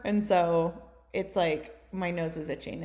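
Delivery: normal talking voice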